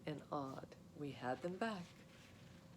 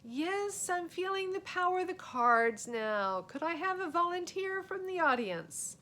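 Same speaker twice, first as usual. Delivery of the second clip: showman magician; 11 semitones higher, 7 dB louder than usual. Acted. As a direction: play the big-time magician to the court. Boy performing magic trick.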